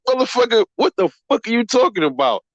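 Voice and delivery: high, exasperated outburst; outraged disbelief. Real, not acted. Streamer scoffs at a fan's claim, exasperated and high. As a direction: pitch jumps up in disbelief.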